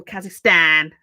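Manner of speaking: Kazakhstan with a nasal, flat Brummie "-stan"; nasal Brummie pronunciation. Retold or imitated. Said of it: "like, really nasally".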